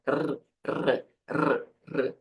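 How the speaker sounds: rolled tongue-tip r, isolated; trilled R. Shown as a demonstration. Teacher showing how the tongue vibrates, trilled R. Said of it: "a língua vibra um pouco mais".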